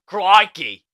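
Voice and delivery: loud, hammed-up Australian accent; put-on Australian twang, pushed loud; mock Australian accent. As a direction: belt out a cartoon Aussie accent. Joking about [name] being in Australia.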